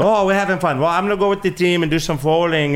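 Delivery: put-on high-pitched, merry German accent; gleeful German guest, hammed up; Friedrich. German CEO off to join the team, gushing German accent. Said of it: "he's German".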